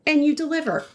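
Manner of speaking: high, fast and sweet; lightly sweet high voice; playful high voice. Acted. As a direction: tease back in a high voice. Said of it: "You're too good at that".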